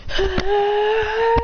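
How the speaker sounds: high crying ah; high anime effort cry; high strained yell. Acted. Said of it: "jumps up in the air going".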